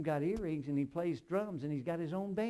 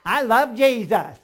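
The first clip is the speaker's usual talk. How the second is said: loud, slow, exaggerated Alabama drawl; imitated Alabama-taught English; 8 semitones higher, 18 dB louder, slower than usual. Preacher imagines Chinese pupil of Alabama teachers, mock drawl. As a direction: stretch each word in a high, mock Alabama twang. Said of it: "Can't you just see a Chinese saying".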